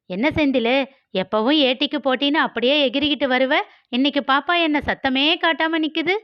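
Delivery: high, quick, mocking girl's voice; teasing girl Malar. Malar mocks Vicky on the street, high and teasing. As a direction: needle him like a cocky young woman.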